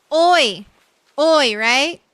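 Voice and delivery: Oi! called out loud and bright as a hello; Oi greeting. Shown as a demonstration. Trying out Oi for Brazilian viewers. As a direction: say Oi like a friendly hello. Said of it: "That's how you say hello".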